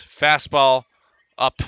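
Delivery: usual speaking voice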